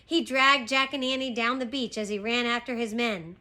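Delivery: plain reading voice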